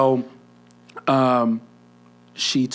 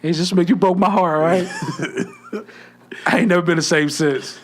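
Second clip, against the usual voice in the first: louder, higher laughing lament; mock heartbreak; 8 semitones higher, 6 dB louder than usual. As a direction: ham up the broken heart. Mock heartbroken address to crush.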